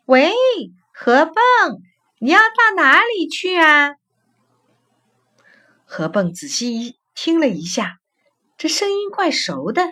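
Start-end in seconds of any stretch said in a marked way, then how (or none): 0.1-4.0 higher-pitched call to a friend. A narrator's reading. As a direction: call out to someone passing by, drawing out the words. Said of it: "忽然听到有谁在喊他". (高声呼喊)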